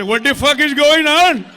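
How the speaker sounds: loud, acted outburst; shouted disbelief. Comic reacting to what he suddenly hears, shouted bewilderment. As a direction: holler it in mock bewilderment.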